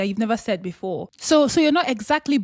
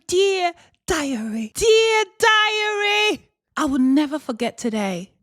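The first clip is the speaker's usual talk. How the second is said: high, very slow, swooning diary reading; dramatic diary voice; 5 semitones higher, slower than usual. Pretend. Speaker pretending to write a diary, slow high swoon. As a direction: croon it like a lovesick diarist. Mock diary entry, drawn out.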